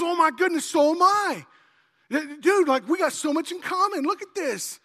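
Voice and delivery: loud, high, gushing exclamation; amazed delight. Acted. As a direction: burst out high and loud, overjoyed. Acted joke, amazed at common ground.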